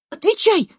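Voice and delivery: high, loud command; sharp demand. A narrator's reading. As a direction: demand an answer at once. Narrator demanding an answer. (резко, требовательно)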